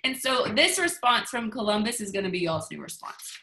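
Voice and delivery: ordinary delivery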